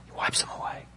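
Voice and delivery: whispered, barely voiced; soft breath of wonder; hushed whisper. Real, not acted. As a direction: drop to a reverent whisper. Hushed aside after loud declaration.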